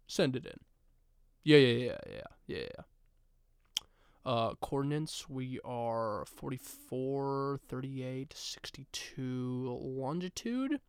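secret agent radio. Pretend. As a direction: murmur it like a covert radio call. Spy cat radioing coordinates.

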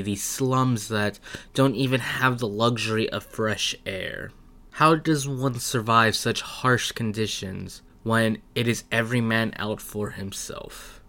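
soft, grave storyteller read of a harsh city; dramatic intro narration. A narrator's reading. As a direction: set the scene like a trailer voiceover. Dramatic opening narration of setting.

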